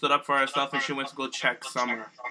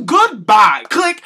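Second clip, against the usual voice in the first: loud, abrupt play-acted goodbye; mock hang-up; 4 semitones higher, 12 dB louder, much slower than usual. Host pretends to cut off Daniel's call, loud and dismissive. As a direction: shout the goodbye, then a high loud click. Acts out hanging up on caller.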